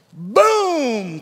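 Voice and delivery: loud, drawn-out boom; stretched, punchy sound effect; impact boom. Retold or imitated. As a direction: boom it out like a big hit. Imitated impact in retold story.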